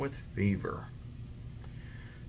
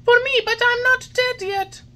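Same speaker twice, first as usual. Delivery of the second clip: put-on high, loud startled child voice; frightened puppet; 9 dB louder than usual. A narrator's reading. Puppet crying out at the coffin-bearing rabbits. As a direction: cry out at the rabbits.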